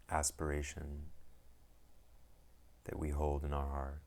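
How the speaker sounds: calm, unhurried meditation guide with long pauses; guided meditation. Acted. Teacher guiding listeners into settling the mind, calm meditation voice. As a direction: ease them into stillness, one phrase at a time.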